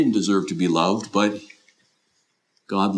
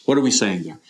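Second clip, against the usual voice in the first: usual speaking voice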